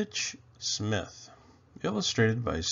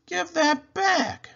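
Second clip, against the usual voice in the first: high, loud and slow; little sister's high, drawn-out protest; indignant demand; 5 dB louder, slower than usual. A narrator's reading. Sister demands her stocking back.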